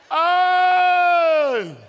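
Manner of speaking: full-force preacher exclamation; excited shout. Real, not acted. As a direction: cry it out in delight. Preacher exclaiming about wife.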